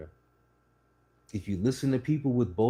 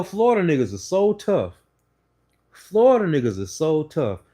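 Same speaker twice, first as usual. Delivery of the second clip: loud, high sarcastic jab; mocking Florida tough talk; sarcastic mockery; 6 semitones higher, 6 dB louder than usual. Real, not acted. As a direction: sneer at their supposed toughness.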